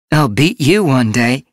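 high, loud playful challenge; cocky boast. A narrator's reading. Apprentice boasting to her mentor, high and loud. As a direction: throw a bold boast at your mentor.

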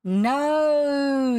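high, loud, ringing no; scornful. A narrator's reading. Boy rejects the captain's guess, scornful. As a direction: let the refusal ring out, drawn out. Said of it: "rang out scornfully".

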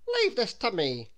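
a hint of a take-charge policeman. A narrator's reading. The policeman answers Mister Jelly, lightly authoritative. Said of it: "replied the policeman".

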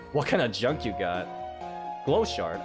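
ordinary delivery

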